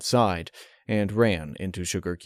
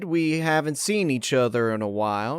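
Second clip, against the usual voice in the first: higher, slow country mare voice; Applejack voice; 9 semitones higher than usual. Acted. Applejack inviting Twilight over, higher and carefully casual.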